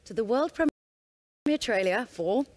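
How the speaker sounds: slow, excited announcement build-up; slow, savoured presenter reveal; deliberately slow. Real, not acted. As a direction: draw each word out for the big reveal. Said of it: "gonna say this slowly 'cause I'm a little bit excited".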